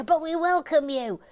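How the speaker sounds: high, loud, proclaimed welcome; ceremonial welcome. Pretend. Host welcomes Paul Tanker in, loud ceremonial welcome. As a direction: hail the newcomer grandly.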